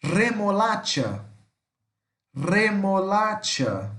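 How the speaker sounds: slow "Remolacha" with a rolled initial R; careful model of "Remolacha", trilled re-mo-LA-tcha. Shown as a demonstration. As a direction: draw out "Remolacha", vibrating the R and saying ch as tch. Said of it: "prestem muita atenção no som do R".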